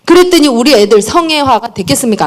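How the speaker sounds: usual speaking voice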